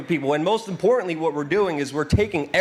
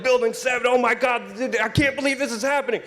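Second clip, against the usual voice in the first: imitating stunned parents, pitched much higher; amazed parents; 8 semitones higher than usual. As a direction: mimic their jaw-dropping shock, high and breathless. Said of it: "They were like".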